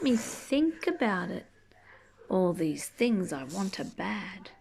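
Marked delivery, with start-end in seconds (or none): none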